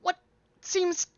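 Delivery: high, stammering, eager-to-help voice; flustered dwarf shopkeeper. Acted. Dwarf shopkeeper asking customers what they need, flustered. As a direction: stumble through it in a high fussy voice.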